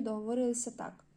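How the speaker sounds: ordinary delivery, nothing marked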